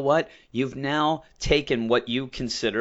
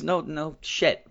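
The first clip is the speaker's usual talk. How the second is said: usual speaking voice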